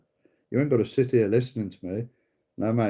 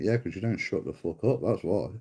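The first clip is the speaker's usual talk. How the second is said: ordinary delivery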